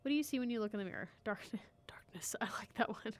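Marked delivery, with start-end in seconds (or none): 1.2-2.4 whisper. Pretend. Mock Batman answer.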